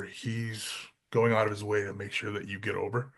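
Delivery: low, soft, firm boss voice; a lightly voiced stern boss, hushed; quiet boss warning. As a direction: lay down the rule quietly as the boss. Vince lecturing, retold.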